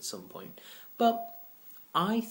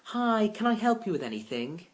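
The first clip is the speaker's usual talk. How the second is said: higher, louder, bright fake cheer; forced joy; 9 semitones higher, 6 dB louder than usual. Chirpy shop assistant greeting a customer, forced joy. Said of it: "forced joy".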